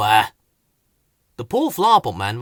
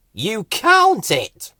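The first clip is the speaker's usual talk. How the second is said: slow, higher, louder punchline; slow, higher big reveal; 7 semitones higher, 5 dB louder, much slower than usual. Acted. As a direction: draw out the punchline. Punchline of explanation.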